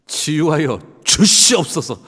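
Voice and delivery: slow, loud, high pompous faith-healer intonation; mock healing prayer. Pastor mocking the expected healing prayer, loud and slow. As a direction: ham up a grand prayer for healing. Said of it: "당연히 ... 할 줄 알았는데".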